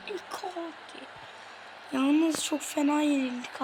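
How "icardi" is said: just murmur the name back down; quiet repeat. Real, not acted. Repeats name after the shout.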